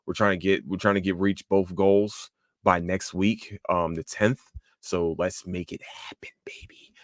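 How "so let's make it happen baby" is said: hushed, voiceless cheer-on; whispered rallying. Real, not acted. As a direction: drop to a whisper to coax listeners. Closing donation plea, drops to whisper.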